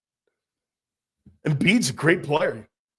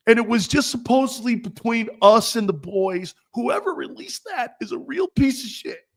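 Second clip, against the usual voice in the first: high, louder whiny mockery; mocking the star's outburst, pitched way up; whiny mock quote; 7 semitones higher, 5 dB louder than usual. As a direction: play the outraged crybaby, voice high. Mocking Embiid's complaint again.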